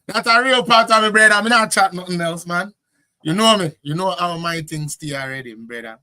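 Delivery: loud, high, punchy Jamaican patois; real Jamaican patois. Shown as a demonstration. Answering a listener doubting his patois, proudly Jamaican. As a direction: fire back in emphatic Jamaican patois. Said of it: "Is this patois or empatois?".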